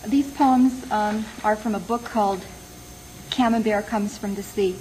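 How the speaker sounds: ordinary delivery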